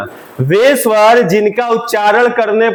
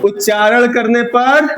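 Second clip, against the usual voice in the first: slow, spaced-out dictation with long pauses; 4 semitones higher, slower than usual. Shown as a demonstration. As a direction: dictate slowly for students to write. Teacher dictates a definition. (धीमे-धीमे रुक-रुक कर लिखवाना)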